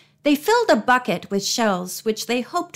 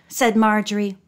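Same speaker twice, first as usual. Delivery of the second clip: ordinary delivery, nothing marked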